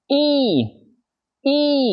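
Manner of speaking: Y drawn out high and tense; a tight English ee with the lips spread; model sound. Shown as a demonstration. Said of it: "mình không đọc y".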